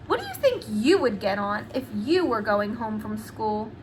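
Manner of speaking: ordinary delivery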